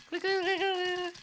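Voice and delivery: very high, rapid-fire camera noise; high-pitched camera imitation; imitated camera clicking. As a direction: squeak out the shutter clicks.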